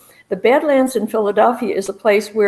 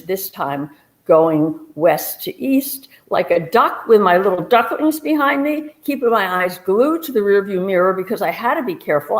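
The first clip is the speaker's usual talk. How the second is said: usual speaking voice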